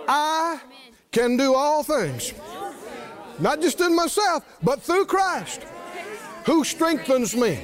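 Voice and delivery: slow, emphatic, high-pitched declaration; preaching the verse with conviction; declared scripture. Shown as a demonstration. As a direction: proclaim each phrase slowly. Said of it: "quoting scripture in faith".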